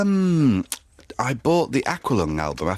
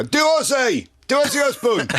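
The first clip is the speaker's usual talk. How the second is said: much higher, louder eager shout; excited cabbie shout; 4 dB louder than usual. Retold or imitated. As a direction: call out like a thrilled taxi driver. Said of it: "cabbies tend to say".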